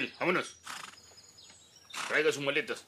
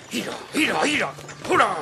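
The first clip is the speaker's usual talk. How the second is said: very high, loud urging cries; driving calls; 12 semitones higher, 5 dB louder than usual. Acted. Driver spurs the horse near the hacienda, high cries. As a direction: yip the horse onward.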